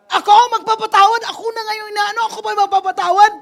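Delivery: high, loud, indignant questioning; angry protest. Retold or imitated. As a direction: shout back in disbelief at being told to forgive. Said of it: "Kasi galit ka eh".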